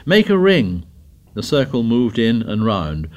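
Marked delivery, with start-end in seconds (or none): 0.1-0.8 high-pitched shouted order; shouting. A narrator's reading. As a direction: call the group into formation. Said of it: "Jack shouted".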